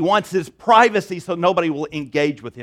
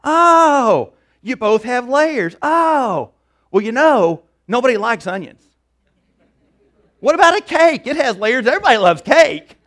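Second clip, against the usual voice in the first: high, loud, eager chatter; excited Donkey; 6 semitones higher, 7 dB louder than usual. Acted. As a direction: pounce on each idea in a high, loud voice. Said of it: "Donkey says".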